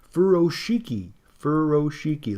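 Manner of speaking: Furoshiki carefully with "shee-kee" at the end; practised pronunciation. Shown as a demonstration. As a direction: drill Furoshiki, clear K in the last syllable. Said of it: "Let's say it together".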